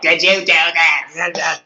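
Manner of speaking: exaggerated take-off of a scolding wife; imitating wife. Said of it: "she does not sound like".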